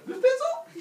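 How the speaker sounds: squeaky, very high and loud; mock innocent question. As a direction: shoot the pitch right up, loudly. Acts out someone defying the warning.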